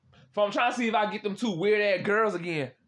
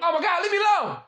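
exasperated outburst; 8 semitones higher, 4 dB louder, faster than usual. Real, not acted. Startled by a stranger on camera.

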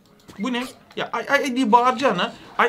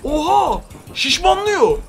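excited shout; 5 semitones higher, slower than usual. Real, not acted. Enemy swells up.

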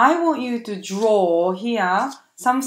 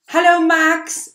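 excited greeting; 8 semitones higher, 8 dB louder than usual. Shown as a demonstration. Greeting the friend Max.

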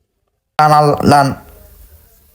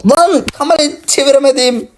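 higher put-on whiny voice, frustrated; mock panicked whine; 10 semitones higher than usual. Frustrated, can't turn camera.

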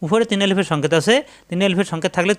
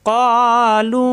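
long, drawn-out chanted recitation; recited slowly; 4 semitones higher, 3 dB louder, much slower than usual. Shown as a demonstration. Teacher demonstrating the recitation, slow chant. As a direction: stretch it out in tajweed chant. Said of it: "Quran recitation demo".

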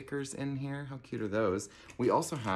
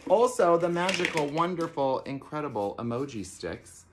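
much higher, louder, grand build-up; excited announcement; 6 semitones higher, 5 dB louder than usual. Real, not acted. Host presenting emoji sticks to campers, excited. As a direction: sell it like a showstopper.

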